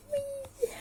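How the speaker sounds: very high, squeaky little yes; mock-cheer in a thin squeal; tiny squealed cheer. Mock cheer at practice exam news.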